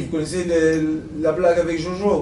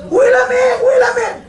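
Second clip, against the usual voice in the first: high, loud complaining cry; wife yelling; 6 dB louder than usual. Acted. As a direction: shriek it at him over the wind. Wife yells in sandstorm. (femme qui crie, voix aiguë et forte)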